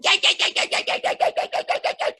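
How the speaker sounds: rapid, high yipping; frightened dog yelps. A narrator's reading. Dogs scared off by lions, fast high yelping. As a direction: yip over and over like fleeing dogs. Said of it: "And the dogs went".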